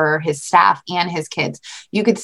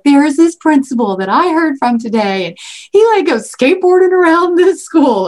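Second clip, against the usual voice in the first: higher, louder and laughing near the end; excited telling; 6 semitones higher, 4 dB louder than usual. Retold or imitated. Telling her kids about the skateboarding principal, high and gushing. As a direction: gush about the cool principal. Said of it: "And I was like".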